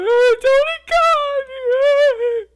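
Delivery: high shrieking laugh; real howl of laughter; loud laughing fit. Real, not acted. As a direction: burst into uncontrolled high laughter. Laughing fit after Tony Khan.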